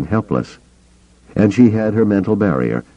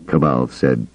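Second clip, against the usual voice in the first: usual speaking voice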